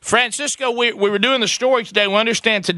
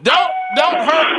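pleading shout; 8 semitones higher, 3 dB louder, slower than usual. Real, not acted. Host pleads loudly.

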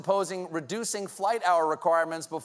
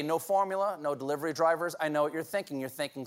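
normal talking voice

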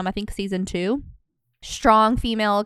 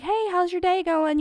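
high, bright sing-song hello; a light imitation of a friendly neighbor; cheery neighbor greeting; 9 semitones higher than usual. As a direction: chirp it over the fence to the neighbors. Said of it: "I used to be like".

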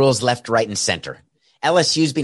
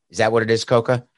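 ordinary talk, nothing marked

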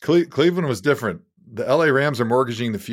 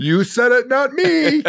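teasing; 12 semitones higher, 4 dB louder, slower than usual. Acted. Teasing jab at co-host.

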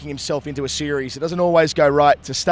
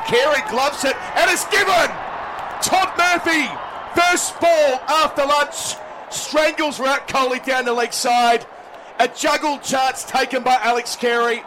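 excited wicket call; 11 semitones higher, slower than usual. Acted. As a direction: erupt as the wicket falls. Wicket falls, commentator erupts.

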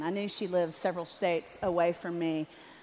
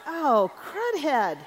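drawn-out, higher mock dismay; dismayed gasp; 5 semitones higher, 3 dB louder, much slower than usual. To the audience, retelling her slip: high slow mock dismay. Speaker catching her own writing mistake.

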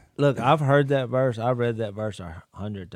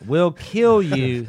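mock threat; 4 semitones higher, 8 dB louder, slower than usual.

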